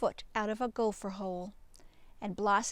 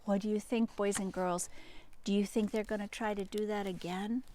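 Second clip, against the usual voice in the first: ordinary delivery, nothing marked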